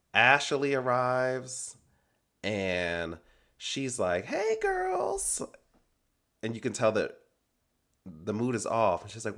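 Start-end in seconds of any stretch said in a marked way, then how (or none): 4.3-5.4 imitated greeting in a very high squeal; high-pitched put-on "girls' night" hello; chirpy mock greeting. Acting out a castmate's arrival greeting.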